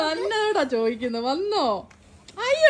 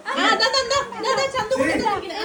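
high-pitched coaxing of a little one; 11 semitones higher than usual. Real, not acted. Mother to sulking child over what Chandu brought, high coaxing.

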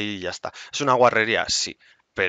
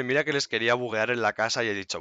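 ordinary talk, nothing marked

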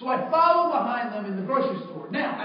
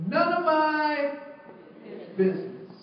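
slow, drawn-out thought with a long pause; deliberate, comic slow-motion aside; minding own business; 3 semitones higher, slower than usual. Retold or imitated. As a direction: stretch it out pointedly, dropping on the last word. Crowd's inner thought, drawn out.

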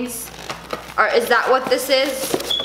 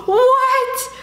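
surprised; 12 semitones higher, 3 dB louder than usual. Real, not acted. Shocked at giant diaper.

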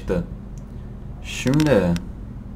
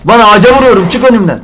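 loud, high exasperated yell; real annoyed shouting; frustrated shout; 8 semitones higher, 10 dB louder than usual. Real, not acted. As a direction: bark at the thing blocking you. Frustrated while chopping tree.

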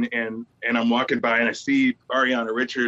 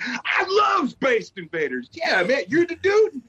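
high, stretched fanboy gushing; excited mock-teen outburst; starstruck excitement; 12 semitones higher, slower than usual. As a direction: gush like a thrilled kid, pitch way up. Starstruck kid meeting movie director.

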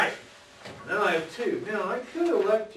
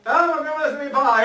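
acted quote; 10 semitones higher, 9 dB louder than usual. Acted excuse to dorm mates.

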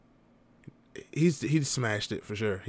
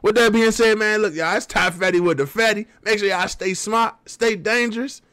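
upbeat, louder channel outro; outro sign-off; 7 semitones higher, 3 dB louder than usual. Real, not acted. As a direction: sign off to the viewers with energy.

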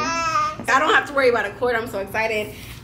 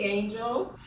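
ordinary talk

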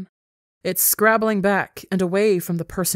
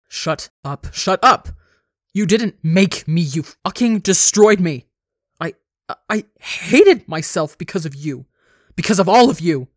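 savage snarl; 8 dB louder than usual. A narrator's reading. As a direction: snarl building into loud, furious shouting. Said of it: "snarls savagely".

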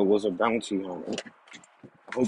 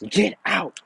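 high-pitched yell, moderately louder; angry yell; 8 semitones higher, 3 dB louder than usual. A narrator's reading. Naruto yelling at Sakura to leave, high angry outburst. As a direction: escalate into a raised, angry command. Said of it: "yells at her".

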